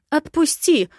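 high, loud cross protest; irritated order to let go; cross demand. A narrator's reading. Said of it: "буркнула".

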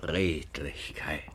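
breathy whispered echo; low whispered aside. Acted. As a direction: whisper it to yourself, slowly savoring. Aside, father mutters.

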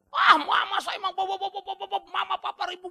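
high, stammering mock yell; high-pitched retold tantrum, loud at both ends; imitated child's outburst. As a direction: act out a kid suddenly screaming and stuttering. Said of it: "langsung dia teriak".

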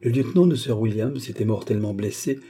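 ordinary delivery, nothing marked

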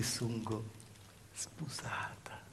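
breathy whisper, drawn out slowly; whispered secret. Acted. Woman confessing her marriage, in a slow whisper. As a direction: let the word slip out as a hushed secret.

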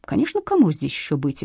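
plain reading voice

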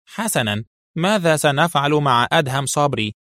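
plain reading voice

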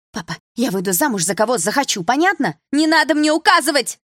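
high, loud yell building; escalating indignant outburst; angry shout. A narrator's reading. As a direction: shout back defiantly at a father. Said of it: "закричала подруга".

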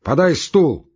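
loud, high barked orders; brusque shouting. A narrator's reading. Postmaster ordering clerk and waiting woman, brusque shout. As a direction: snap out orders across the room. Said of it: "крикнул он бабе".

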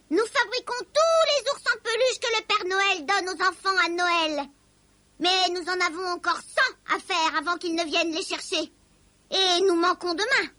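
high, sharp little elf voice; squeaky grumpy elf; disagreeable elf. A narrator's reading. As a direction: explain it in a high, peevish elf voice. The disagreeable elf explains their trouble.

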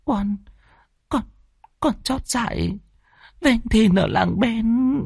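high, halting explanation; young daughter pleading her lover's case through tears; tearful confession. Acted. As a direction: confess timidly in broken pieces. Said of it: "Tâm hai mắt đẫm lệ".

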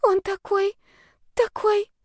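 put-on very high, dreamy girl's voice; blissfully lovesick. A narrator's reading. Girl describes her beloved, high and dreamy. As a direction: swoon over him in a high voice.

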